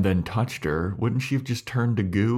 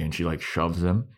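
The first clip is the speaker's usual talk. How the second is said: normal talking voice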